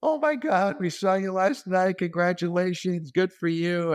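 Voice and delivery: high, excited gushing; gushing flight attendant. Retold or imitated. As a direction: gush like a starstruck fan.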